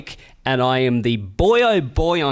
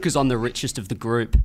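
ordinary talk

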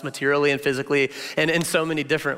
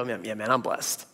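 softer, lower, contented sigh; quiet contentment; 7 semitones lower, 7 dB quieter than usual. Retold or imitated. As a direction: murmur it to yourself, satisfied. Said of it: "I'll look at my life and go".

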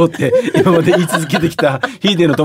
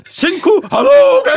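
high, slow special-move yell; fighting game shout; slower than usual. Pretend. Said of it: "波動拳だったね".

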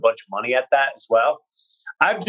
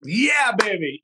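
hyped up; 6 semitones higher, slower than usual. Real, not acted. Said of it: "hype yourself up".

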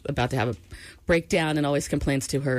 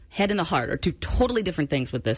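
ordinary talk, nothing marked

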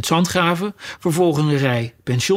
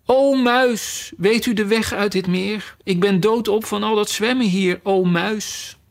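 high, ceremonious appeal to a mouse; lofty, pleading little-girl appeal; pleading address; 6 semitones higher than usual. Acted. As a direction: call out politely for help in a higher voice. Alice formally addresses the mouse.

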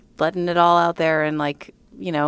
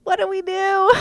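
high, loud flustered question; happy panic, no idea what next; giddy panic; 6 dB louder than usual. Retold or imitated.

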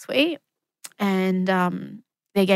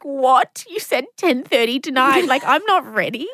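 shocked disbelief; 6 semitones higher, 3 dB louder than usual. Retold or imitated. Shocked at sudden full dilation.

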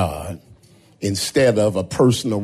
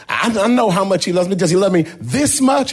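put-on higher, fast, a boy sure he knows; eager child; 8 semitones higher, much faster than usual. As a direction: blurt it out like an eager pupil. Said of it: "He said".

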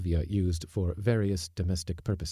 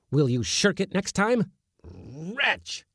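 very high, exasperated scolding; 9 semitones higher, slower than usual. A narrator's reading. Uncle scolding the kitten to look at the mouse, high. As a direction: berate the cowardly kitten.

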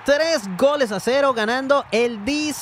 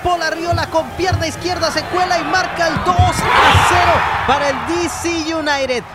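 goal call; 4 semitones higher, 3 dB louder than usual. Real, not acted. Goal scored in match recap.

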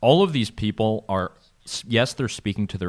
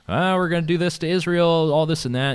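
higher and louder mock bluster; mocking Ahmadinejad; 10 semitones higher, 5 dB louder than usual. Ahmadinejad threatening Israel, mocked on a podcast: loud, high bluster. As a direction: ham up a leader's blustering threat, pitched up and loud. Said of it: "with, like, being like".

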